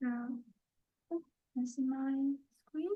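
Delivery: faint, far-off mic voice; speaking too softly into a weak mic; barely audible. Real, not acted. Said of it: "your voice is very low".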